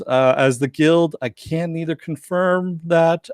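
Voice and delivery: slow, careful bureaucratic hedging; evasive official. Retold or imitated. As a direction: dodge the question like a spokesman. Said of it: "He goes".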